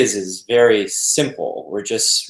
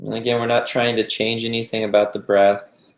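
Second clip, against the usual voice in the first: calm, unhurried meditation guide with long pauses; guided meditation. Acted. Teacher guiding a group to relax into the breath, calm meditation voice. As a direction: ease them gently into stillness, leaving space between phrases.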